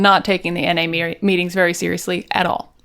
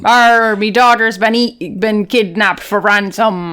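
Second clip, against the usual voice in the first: put-on pirate accent; slower than usual. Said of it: "you do a pirate accent".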